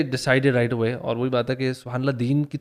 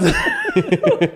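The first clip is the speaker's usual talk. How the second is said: laugh; 4 dB louder than usual. Real, not acted. Host bursts out laughing recalling the video.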